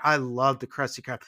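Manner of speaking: normal talking voice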